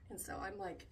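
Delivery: normal talking voice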